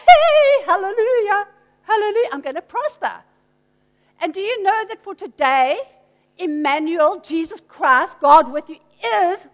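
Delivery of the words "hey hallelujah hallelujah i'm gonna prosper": loud high cheer of joy; excited Mary. Acted. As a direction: burst out shouting hallelujah. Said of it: "when she heard God was gonna be with her".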